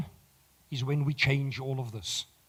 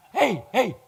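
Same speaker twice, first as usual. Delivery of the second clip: calling for attention; 7 semitones higher, 10 dB louder than usual. Real, not acted. Quieting the audience.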